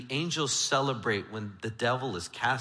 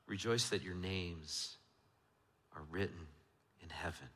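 Jesus's words, much softer and low; hushed quote; 8 semitones lower, 11 dB quieter than usual. Acted. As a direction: let the promise fall away to a hush.

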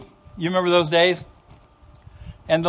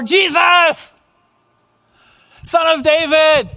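very high, drawn-out shout; acted all-out cry to Jesus; louder shout; 7 semitones higher, 3 dB louder, slower than usual. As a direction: shout it higher, straining to be heard. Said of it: "he probably even said it louder".

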